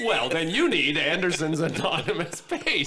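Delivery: high, eager ad pitch with a laugh; infomercial pitch. Acted. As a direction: sell it like a cheesy TV ad. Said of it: "has this ever happened to you".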